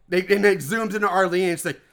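normal talking voice

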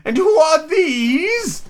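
very high, loud, drawn-out shout; imperious Queen. A narrator's reading. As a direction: demand answers as an outraged queen. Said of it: "said the Queen".

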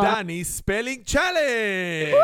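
slow, loud game-show announcement; announcer. Acted. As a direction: announce the show title grandly. Host announces game title.

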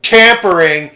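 loud, high indignant outburst; mock outrage. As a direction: imitate aggrieved voters crying foul. Retells young voters' outrage.

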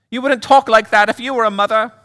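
very high, affronted British lady; Pam. Acted. Mother hurt her son stayed away, high British accent. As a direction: complain in a high British woman's voice. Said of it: "do a little accent work".

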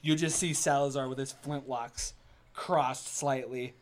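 normal talking voice

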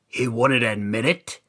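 low, soft storyteller's aside; hushed narration. A narrator's reading. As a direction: let it sink almost to a murmur. Repeated line, hushed ending.

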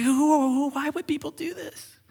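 high, thinning mock sob; imitated weeping. Preacher imitating a pastor weeping over Exodus, tearful. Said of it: "the pastor just started weeping".

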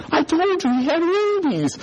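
high, drawn-out told-you-so; mock accusation. Brother walking by to tease Anton, mock accusation. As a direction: rub it in, high and slow.

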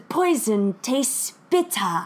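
slow, robotic narrator imitation; weird narrator voice. Mimicking the evil robot narrator, slow and weird. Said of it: "She said it very weird".

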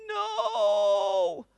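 long, high, loud mock wail of "No"; anguished cry. Boy left stunned at the film's end, anguished cry. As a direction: cry out in drawn-out, hammed-up disbelief.

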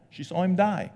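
normal talking voice

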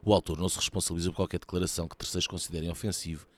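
fast, low, soft legal small print; rapid, subdued announcer read; disclaimer read. Real, not acted. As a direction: rattle off the disclaimer. Reads programme disclaimer.

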